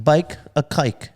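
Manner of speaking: slow, spaced out bake a cake; slow and careful "bake a cake". Shown as a demonstration. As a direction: say "bake a cake" slowly, each word apart. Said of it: "Just say that again".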